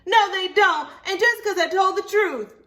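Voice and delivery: put-on high and loud, insistent little girl; upset child voice. Libby protesting to Mama that friends are mad, high and loud.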